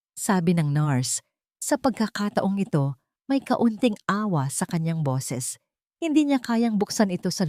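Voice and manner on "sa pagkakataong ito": usual speaking voice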